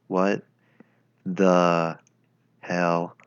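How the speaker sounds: usual speaking voice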